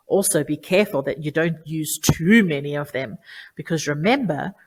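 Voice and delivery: ordinary talk, nothing marked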